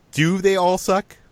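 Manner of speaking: ordinary talk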